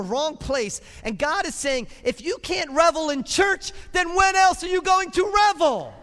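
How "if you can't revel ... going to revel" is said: booming God voice. Acted. Preacher booming God's words to the church, loud and high. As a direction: proclaim it like God is calling to the church.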